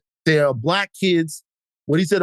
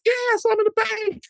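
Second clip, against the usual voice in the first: gossiping Southern lady. Retold or imitated. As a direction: ham up a nosy church lady spotting him. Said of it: "when they describing your wife".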